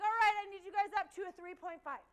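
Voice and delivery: loud coaching yell; trainer shouting. Retold or imitated. Trainer yelling orders at the red team, loud. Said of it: "She's like".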